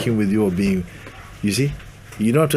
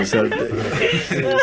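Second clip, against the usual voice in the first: normal talking voice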